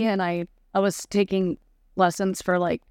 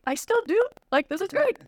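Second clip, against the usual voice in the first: high, near-tearful delight; overjoyed gush; 11 semitones higher than usual. Retold or imitated. Praised for her accent, she gushes with joy. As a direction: blurt it out, thrilled and moved. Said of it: "I'm like".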